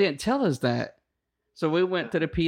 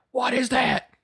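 higher, sharp instructor question; mock outraged question; 9 semitones higher, slower than usual. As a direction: demand it high and sharp. Instructor spots underwear.

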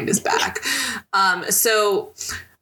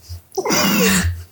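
a sudden high giggle; high bubbly laugh. Real, not acted. Co-host teases her about blushing, giggling high.

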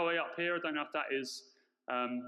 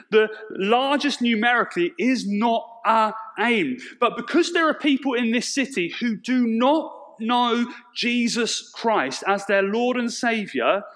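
loud, passionate sermon voice; preaching; 11 dB louder than usual. Acted. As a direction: preach it out to the congregation. Sermon at full force.

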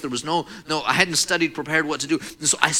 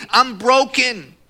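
loud, high, drawn-out lament; anguished cry; 8 semitones higher, 6 dB louder, much slower than usual. Retold or imitated. Young man despairing over his injury, loud anguish. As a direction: cry it out slowly at full voice. Acts out despairing thought, loudly.

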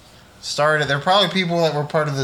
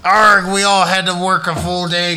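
pirate voice; 3 semitones higher, 4 dB louder than usual. Acted. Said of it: "pirate episode".

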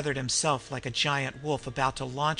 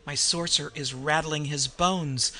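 plain reading voice

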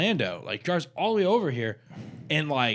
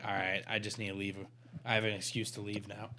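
very soft, low retelling of an excuse; retold brush-off; 6 semitones lower, 13 dB quieter than usual. Date excusing herself to leave, retold as a quiet brush-off. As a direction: murmur the excuse as if slipping away.

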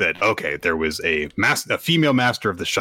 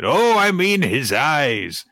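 put-on old man voice; 9 semitones higher than usual. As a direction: voice the awed old performer. Old rakugoka voiced in story.

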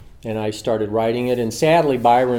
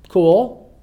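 mock casual cool; 9 semitones higher, 8 dB louder than usual. Calling a casual reply.

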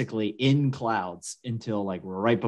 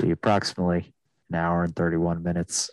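lower, even captain's cabin announcement; airline pilot; 5 semitones lower than usual. Retold or imitated. Mocking how every pilot in America sounds, low pilot voice. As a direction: imitate a calm pilot on the PA. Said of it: "talk like".

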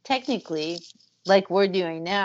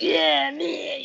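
raised, growly game-character voice; 8 semitones higher than usual. Retold or imitated. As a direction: growl it like a tough cartoon heroine. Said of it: "kinda growly voice".

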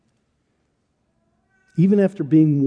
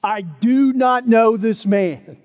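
louder, higher, slowing to a pounding end; desperate insistence; 6 semitones higher, 4 dB louder than usual. Acted. Peter convincing everyone around the fire, loud and desperate. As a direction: hammer home each denial. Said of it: "He gave it his all to convince everyone".

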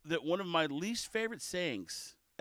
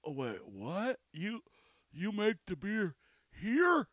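baffled, halting disbelief with HERE stretched and rising; slow double-take, stumbling over the question; confused customer; slower than usual. Acted. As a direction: act out a puzzled, incredulous customer. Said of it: "the confused look and the head cocking".

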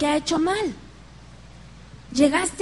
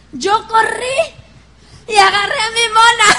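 higher and louder, rushing through the panic; excited retelling; 7 semitones higher, 7 dB louder than usual. Retold or imitated. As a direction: relive the dash for the figurine. Earthquake panic retold. (relato emocionado, más agudo y fuerte)

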